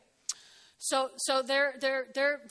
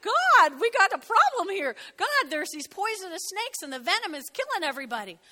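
louder, higher desperate plea; cries out to God; 6 semitones higher, 8 dB louder than usual. Retold or imitated. Moses crying out to God over snakes, loud and urgent. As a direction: call up to God in alarm. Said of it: "cries out to God".

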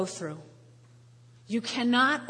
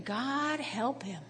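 slow pitying prayer; 8 dB quieter, much slower than usual. Retold or imitated. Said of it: "And I'm thinking".

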